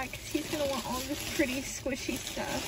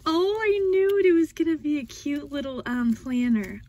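excited; 8 dB louder, faster than usual. Real, not acted. Delighted reveal of planner.

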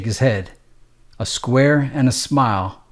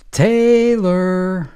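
called out higher across the clinic, drawn out; calling a name, higher; 7 semitones higher than usual. Acted. Nurse calling the next patient, higher and drawn out. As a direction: sing the name out across the room. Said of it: "She was calling out names".

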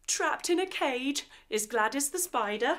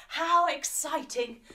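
plain reading voice

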